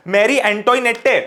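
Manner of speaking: मैरी एंटोइनेटे said slowly, letter by letter, with a sounded final "te"; spelling pronunciation. Shown as a demonstration. Teacher shows students his memory trick, saying एंटोइनेटे as spelled. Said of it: "मैं कैसे याद करता था".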